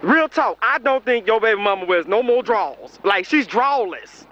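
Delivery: normal talking voice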